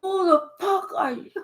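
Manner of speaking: a hammed-up shocked stranger; mock outrage. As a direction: play the shocked crowd. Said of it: "They like".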